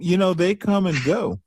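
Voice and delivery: ordinary talk, nothing marked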